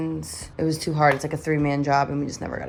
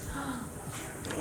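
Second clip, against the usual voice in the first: gasp; 6 semitones higher, 12 dB quieter than usual. Real, not acted. Touched gasp at partner cooking.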